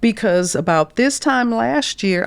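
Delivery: ordinary talk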